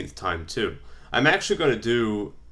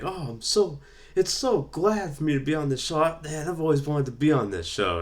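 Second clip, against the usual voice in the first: high, gushing blue chipper, a light imitation; eager wrestler voice; 7 semitones higher than usual. Wrestler imitated on a talk show, lightly voiced, high and eager. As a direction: gush like a thrilled rookie. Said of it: "He's like".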